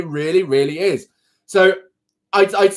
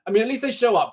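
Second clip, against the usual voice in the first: ordinary delivery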